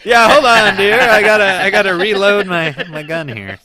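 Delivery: loud, high panicked hunter voice; hammed-up fumbling hunter; flustered hunter. Plays flustered musket hunter.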